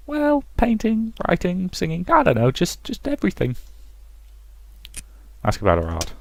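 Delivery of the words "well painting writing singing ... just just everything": artist character. Acted. Artist telling an admirer of her arts, high falsetto. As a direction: list them haltingly in a high put-on voice.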